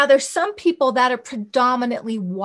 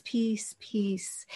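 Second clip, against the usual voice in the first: "peace, peace" whispered, softer and softer; 2 semitones lower, 11 dB quieter than usual. Shown as a demonstration. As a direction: breathe "peace" gently out. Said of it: "but peace".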